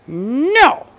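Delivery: loud high defiant no; a boy's flat refusal; shouted no. A narrator's reading. Thomas refuses his mother, shouting. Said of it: "And Thomas said".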